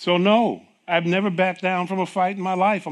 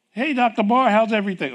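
cheerful greeting; 6 semitones higher, 5 dB louder than usual. Retold or imitated.

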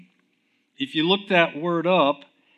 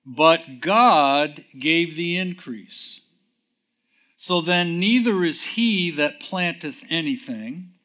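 solemn King James reading; scripture reading; 2 semitones higher, 4 dB louder than usual. Acted. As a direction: read the verse with weight.

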